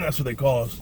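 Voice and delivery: ordinary delivery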